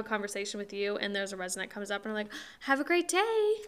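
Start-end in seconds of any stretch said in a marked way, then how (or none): 2.6-3.5 high, loud sing-song server greeting; customer service voice. Retold or imitated. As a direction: put on the polite waitress voice. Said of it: "talking to you in a normal voice".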